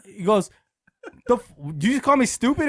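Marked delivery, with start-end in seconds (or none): 1.3-2.7 higher-pitched, mildly indignant retort; offended. Retold or imitated. Coworker just called stupid by prank caller, offended. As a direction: imitate someone taking offence. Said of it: "He's getting offended now".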